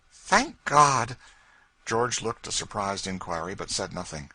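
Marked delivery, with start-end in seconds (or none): 0.1-1.2 loud, high cry of relief; loud relieved thanks. Acted. Lady learning her brother is free, loud relief. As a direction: burst out thankful.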